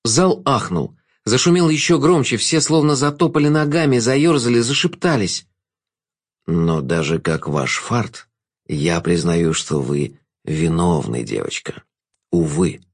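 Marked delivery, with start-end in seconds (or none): 6.5-12.8 low, slow, sinking voice; low, regretful-sounding trickster; Jester passing verdict. A narrator's reading. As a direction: pronounce guilt slowly, dropping low at the end.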